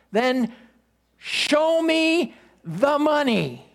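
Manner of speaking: hammed-up Rod Tidwell, insisting; emphatic football player.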